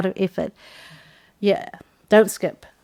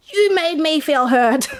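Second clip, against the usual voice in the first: slow, higher accusing line; drawn-out blaming "You made me feel hurt" pitched up; 7 semitones higher, slower than usual. Shown as a demonstration. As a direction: point the finger at them. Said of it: "Don't say".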